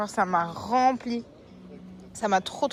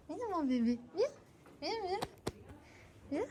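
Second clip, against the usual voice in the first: high soft calling to a pet; coaxing call; 7 semitones higher, 8 dB quieter than usual. Real, not acted. Calling the cat to her lap, high and coaxing. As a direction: coax the cat to come.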